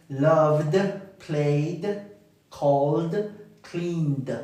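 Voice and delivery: Loved, played, called, cleaned ending in a voiced D. Shown as a demonstration. Teacher listing voiced verbs, ending on D. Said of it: "sonidos vibrantes".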